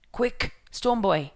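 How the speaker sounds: loud, slow urgent wake-up call. Acted. Hideaway wakes Stormboy during the storm, calling loud and slow. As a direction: rouse the boy over the storm.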